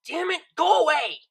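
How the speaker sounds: sudden furious yell, high and loud; shouted. Acted. Vinyl yells at her unseen worries in frustration. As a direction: explode at the intruder.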